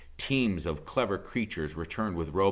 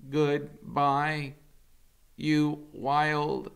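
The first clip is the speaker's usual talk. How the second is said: higher, slow robot voice calling out; robot's farewell call; 11 semitones higher, 5 dB louder, much slower than usual. Acted. Roz calling goodbye to the island animals, high and slow. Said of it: "Roz's voice echoed".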